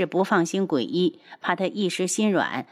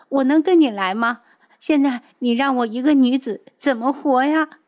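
put-on higher, whiny girlish voice begging her big brother; tearful young woman pleading; 6 semitones higher, 4 dB louder than usual. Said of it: "柳儿正哭哭啼啼地看着鬼医".

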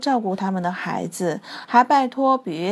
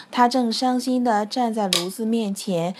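ordinary delivery, nothing marked

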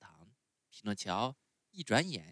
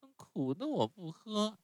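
put-on much higher, whiny little boy; puppet boy voice; 12 semitones higher than usual. A narrator's reading. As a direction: sulk like a spoiled child refusing medicine. Pinocchio voiced in story.

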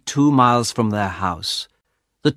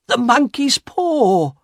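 high, slow, urgent naming; insistent; 12 semitones higher, slower than usual. A narrator's reading. Wife tells husband what she wants, insistent. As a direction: stretch it out with eager insistence.

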